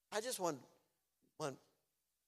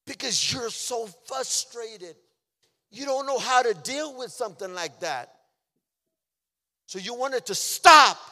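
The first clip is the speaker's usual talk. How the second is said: high, loud, forceful preaching; impassioned preaching; 8 semitones higher, 15 dB louder than usual. Real, not acted. Preacher explaining his frustration to church, forceful and raised.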